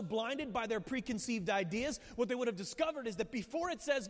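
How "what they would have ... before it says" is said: ordinary delivery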